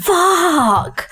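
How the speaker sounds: high, stunned swear of disbelief; the word that escapes when the news lands; shocked swear. Retold or imitated. Reaction to triplets news.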